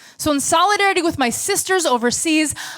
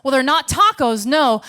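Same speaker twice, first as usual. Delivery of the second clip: ordinary talk, nothing marked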